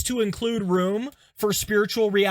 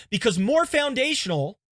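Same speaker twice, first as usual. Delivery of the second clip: normal talking voice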